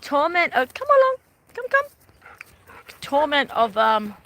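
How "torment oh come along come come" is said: high call to the dog. Real, not acted. Calling her dog on the walk, high call. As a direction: call him over brightly, louder.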